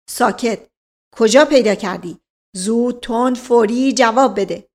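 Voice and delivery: overbearing inspector's grilling; bullying interrogator. Acted. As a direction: press for an instant answer. Inspector resumes grilling servant.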